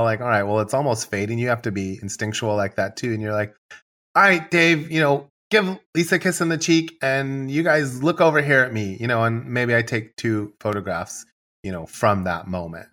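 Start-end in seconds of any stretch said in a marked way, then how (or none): 4.1-9.0 lightly acted, higher-pitched instructions; photographer giving direction. Photographer steering the couple for a shot, lightly acted direction.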